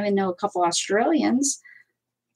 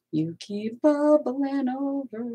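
slow, higher, stretched-out words; playful drawn-out complaint; 5 semitones higher, much slower than usual. Pretend. Crafter complaining to bubbling glue, playfully drawn out. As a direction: draw each word out in playful reproach.

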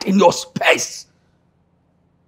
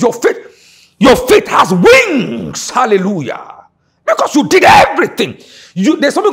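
preaching shout; 6 semitones higher, 5 dB louder than usual. Real, not acted. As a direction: stretch every word in a big shout.

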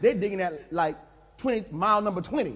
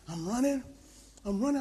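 ordinary delivery

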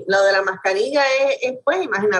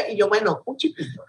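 ordinary talk, nothing marked

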